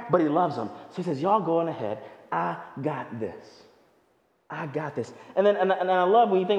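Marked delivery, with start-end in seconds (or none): 1.2-5.1 mild reassuring Jesus. Retold or imitated. Jesus voiced reassuring disciples.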